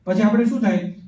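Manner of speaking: ordinary delivery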